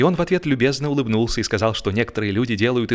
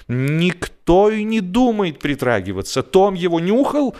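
higher, louder indignant woman's voice; indignant wife; 8 semitones higher, 3 dB louder than usual. A narrator's reading. Friend's wife indignant about the cheese, higher and louder. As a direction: bristle at the very idea.

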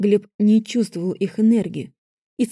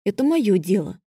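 ordinary delivery

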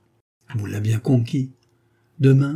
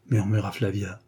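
usual speaking voice

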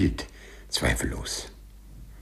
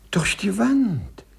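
drawn out, high and amazed; the idea suddenly hits him; dawning amazement; 10 semitones higher, slower than usual. Acted. Clerk realising he can pass walls, slow high amazement.

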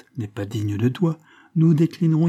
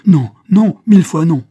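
refus crié; 6 semitones higher, 12 dB louder than usual. Acted. Marthe refuse la demande.

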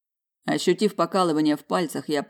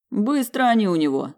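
forced enthusiasm; 5 semitones higher, 4 dB louder than usual. Acted. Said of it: "пытаясь звучать воодушевлённо".

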